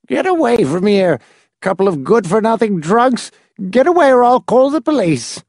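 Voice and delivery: put-on high, rising angry old man shouting them off; shrill old man's threat. A narrator's reading. The old man threatens the drunks at his door, high and angry.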